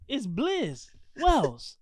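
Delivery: slow Blizz Wells with a short i, falling on Wells; correct pronunciation. Shown as a demonstration. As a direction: spell it out slowly, Blizz like blizzard. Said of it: "It's English".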